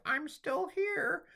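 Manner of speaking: very high, slow, squeaky little voice; tiny iota clinging on. Acted. Forgotten iota insisting it exists, tiny high voice. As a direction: squeak it out as a tiny hanging letter. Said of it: "iota hanging on for dear life".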